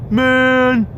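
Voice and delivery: imitating a kid mouthing off, loud and cocky; kids talking back. Retelling the thieving kids at Dollar Tree taunting him, loud mock taunt. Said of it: "start talking shit to me".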